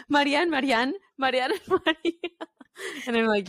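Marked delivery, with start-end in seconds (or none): 0.1-2.1 mocking confused strangers. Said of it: "And they're like".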